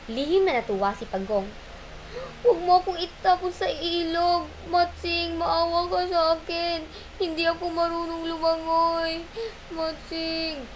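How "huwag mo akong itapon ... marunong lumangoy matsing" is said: higher, pretend begging; mock pleading. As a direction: fake fear to trick him. Pagong fakes begging.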